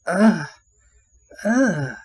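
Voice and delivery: loud, high imitated moaning grunts; mock sexual moan. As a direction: imitate her suggestive grunts, loudly. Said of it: "sounds like she's fucking moaning".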